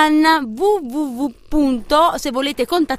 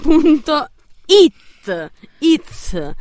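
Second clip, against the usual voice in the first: slow drawn-out; 3 semitones higher, 3 dB louder, much slower than usual. Acted. Drawn-out address ending.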